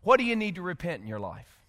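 low, soft and quick; hushed searching question. Real, not acted. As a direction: drop to a quiet, personal question. Searching question put to congregation.

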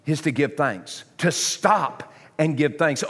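usual speaking voice

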